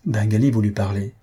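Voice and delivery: ordinary delivery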